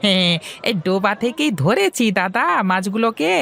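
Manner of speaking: put-on high, eager young man's voice; young fish seller Hari. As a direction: talk up to your elder brightly. Hari explains the pond. (উঁচু গলায় উৎসুক তরুণ হরি)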